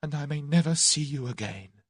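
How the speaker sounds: high, plaintive, sobbing confession; tearful cry. A narrator's reading. Tuppence weeping to Tommy's photograph, heartbroken. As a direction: wail it, heartbroken. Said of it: "she cried".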